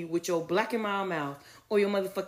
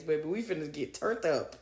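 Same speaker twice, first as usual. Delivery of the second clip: ordinary delivery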